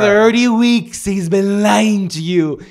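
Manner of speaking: slow, loud, high mock accusation; mock outrage. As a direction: expose him dramatically.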